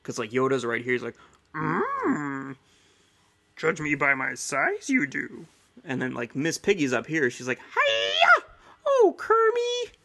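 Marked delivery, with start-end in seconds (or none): 1.5-5.5 slow, strange little sage voice; Yoda impression voice. Shown as a demonstration. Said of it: "I can do Yoda". 7.6-9.9 shrieking very high, louder diva cry; Miss Piggy voice. Shown as a demonstration. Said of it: "I can do Miss Piggy".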